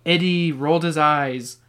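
usual speaking voice

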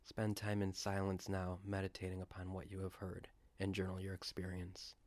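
ordinary talk

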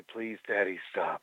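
low, soft, teasing sultry voice. Pretend. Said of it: "Could you do that in your sultry voice?".

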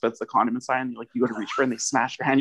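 usual speaking voice

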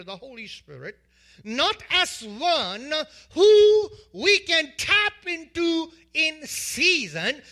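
building sermon delivery; preaching. Acted. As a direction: preach it with rising fervor. Preaching with rising force.

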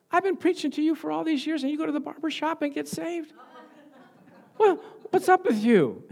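hammed-up angry wife voice. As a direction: squawk it like an exasperated wife. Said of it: "And she got angry".

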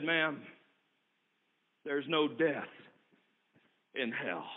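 very low, soft, dropping to near silence; grave hush. Acted. Keeper of hell telling the sister, low and hushed. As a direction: let the verdict sink into a hush.

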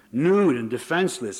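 ordinary delivery, nothing marked

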